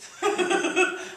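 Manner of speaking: loud, high laugh through tears; a real, emotional laugh; teary laugh. Real, not acted. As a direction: burst out laughing, overwhelmed. Overwhelmed, trying to breathe.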